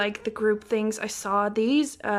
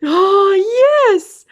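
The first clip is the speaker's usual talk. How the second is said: much higher, much louder cry of joy; ecstatic scream; 9 semitones higher, 14 dB louder than usual. Real, not acted. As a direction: scream it out in triumph. Screams on pulling the wanted card.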